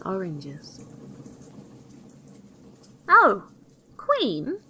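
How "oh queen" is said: high, loud little exclamations; high-pitched dwarf calling out to the queen; yellow dwarf. A narrator's reading. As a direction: pipe up as a cheeky storybook dwarf. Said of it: "cried the yellow dwarf".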